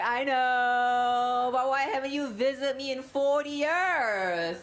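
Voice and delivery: put-on high, sassy ex-girlfriend reply; sassy Singapore voice. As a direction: toss it back like a smug ex. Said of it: "And we're like".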